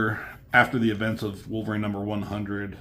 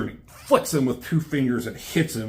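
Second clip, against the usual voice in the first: usual speaking voice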